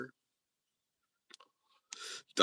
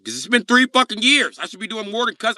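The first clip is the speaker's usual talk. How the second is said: louder, higher street demand; loud Jacob demand; 13 semitones higher, 10 dB louder than usual. Retold or imitated. Jacob demanding cash from the debtor. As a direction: bark it at the debtor.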